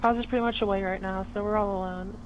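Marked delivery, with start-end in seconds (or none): none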